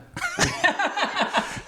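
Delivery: falling peal of laughter; burst of high laughter. Real, not acted. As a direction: crack up at the joke. Laughs at the punchline.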